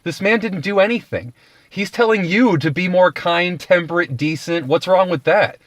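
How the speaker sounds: high, exasperated pleading; incredulous Pilate. Retold or imitated. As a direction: plead with the crowd in disbelief. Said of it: "He was like".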